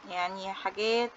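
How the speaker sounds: ordinary delivery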